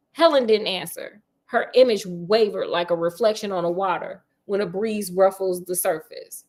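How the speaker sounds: ordinary delivery, nothing marked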